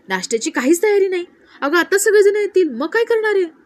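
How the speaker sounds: high, loud fussing; agitated scolding. A narrator's reading. Sita उर्मिलाला जाब विचारते. (थोडं वैतागून, चढ्या आवाजात जाब विचारणं)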